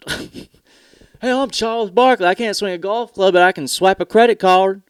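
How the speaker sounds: over-the-top celebrity spokesman; Charles Barkley impression. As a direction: ham up a star's ad line.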